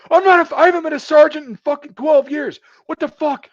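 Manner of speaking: very high loud outraged shout; furious yell. Retold or imitated. Gunnery sergeant yelling at a specialist for calling him sergeant, furious. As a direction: scream the correction at her. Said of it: "He goes".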